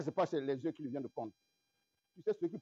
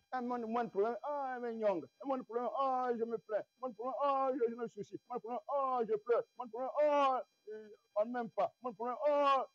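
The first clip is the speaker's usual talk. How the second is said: higher, whiny sighing complaint on each ah; mock whining complainer; 5 semitones higher than usual. Preacher mocking believers who whine over small problems, high whiny mock complaint. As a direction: play a petty believer moaning over nothing, higher.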